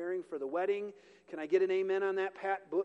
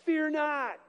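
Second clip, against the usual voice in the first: higher, ringing call; angel's greeting; 8 semitones higher, 5 dB louder than usual. Retold or imitated. Angel appearing to Mary in her room, ringing and bright. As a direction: announce it like a heavenly messenger. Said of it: "and says what?".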